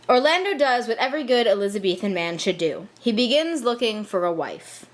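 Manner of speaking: ordinary talk, nothing marked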